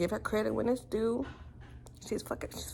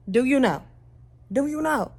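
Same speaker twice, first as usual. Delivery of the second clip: louder, pressing question; insistent challenge; 5 semitones higher, 8 dB louder than usual. Real, not acted. Vlogger challenging viewers, loud and insistent. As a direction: demand an answer from the camera.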